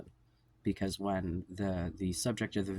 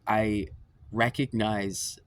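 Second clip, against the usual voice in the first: ordinary talk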